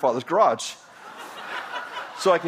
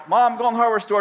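higher, louder call to mom; yelling to mom; 6 semitones higher, 3 dB louder, faster than usual. Retold or imitated. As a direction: call out across the house. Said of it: "I yell".

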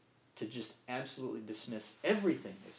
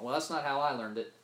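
dismissive retort; 3 semitones higher, 7 dB louder than usual. Retold or imitated. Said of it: "it's the same thing as just turning it off".

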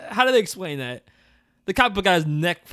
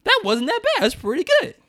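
mildly surprised. Retold or imitated. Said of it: "It just means I was like".

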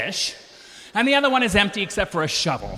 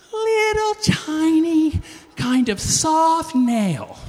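mock tiny; 7 semitones higher, much slower than usual. Describing a nail, slowed.

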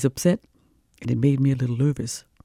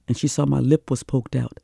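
usual speaking voice

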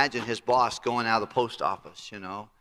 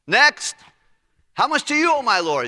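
high, loud call to the next in line; 10 semitones higher, 8 dB louder than usual. Retold or imitated. Steward calls out to waiting debtors, loudly.

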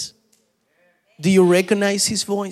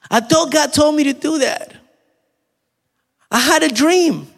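high-pitched, louder mock protest; mocking a confused believer; 10 semitones higher, 4 dB louder than usual. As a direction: play up the naive believer's excuse, pitched way up. Mocks a confused believer's excuse.

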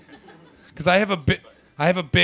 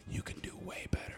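stage whisper; 16 dB quieter than usual. Acted. Aside whisper to the woman.

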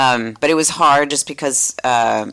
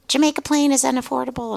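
high, put-on voice for other people; lightly mimicked complaining locals; quoting people; 11 semitones higher than usual. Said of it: "people were like".

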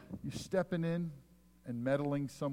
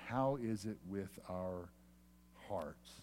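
soft closing question; 5 semitones lower, 8 dB quieter, slower than usual. Real, not acted. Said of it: "sermon closing question".

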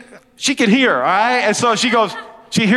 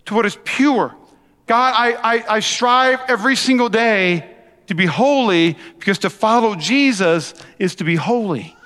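ordinary talk, nothing marked

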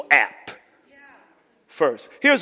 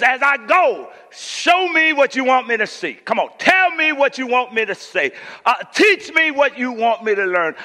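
high, insistent preacher's plea; loud, rhythmic preacher impression; Doctor Fred Sampson; 7 semitones higher, 4 dB louder than usual. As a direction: call it out like an imitated pulpit prayer. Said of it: "That's how he talk".

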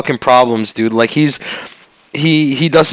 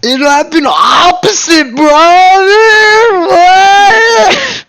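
high, loud wrestler mock cry; hammed-up wrestler voice; 12 semitones higher, 3 dB louder, much slower than usual. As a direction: wail it like a ham wrestler. Hammed-up Hulk Hogan parody.

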